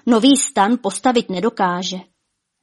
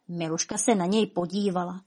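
ordinary delivery

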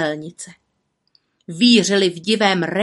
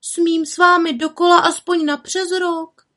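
little witch asking; 10 semitones higher, 3 dB louder than usual. A narrator's reading. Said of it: "zeptala se".

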